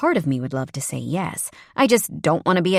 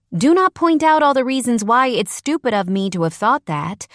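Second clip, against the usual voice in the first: high, loud embarrassed plea; flustered protest; 8 semitones higher, 5 dB louder than usual. Acted. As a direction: stop him, pitched up and loud.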